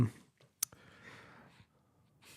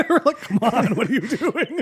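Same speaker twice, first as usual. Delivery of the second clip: laughing; 11 semitones higher, 6 dB louder than usual. Retold or imitated. Laughing mock plea.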